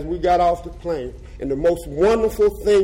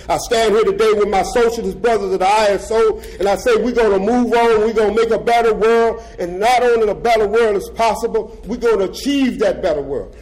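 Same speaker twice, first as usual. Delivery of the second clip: louder, a touch higher, rallying; rousing declaration; 5 semitones higher, 6 dB louder than usual. Real, not acted. Speaker promising comrades a better world, rousing. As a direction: lift the room with conviction.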